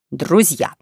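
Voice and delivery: solemn, weighty announcement. Acted. Young swindler about to reveal his secret to companions, solemn tone. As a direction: address them with grave ceremony. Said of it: "сказал молодой торжественным тоном".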